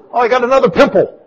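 hammed-up fretting over a tiny problem; mock dismay. Mimics petty irritation, comic.

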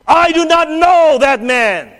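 slow, loud, high shout of denial. Acted. Peter denying the Lord right after boasting, loud and slow. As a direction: throw the denial out hard.

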